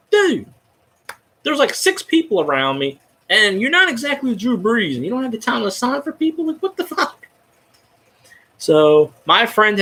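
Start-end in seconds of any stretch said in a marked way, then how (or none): 0.1-7.3 indignant. Retold or imitated. Retold complaint to a snubbing player.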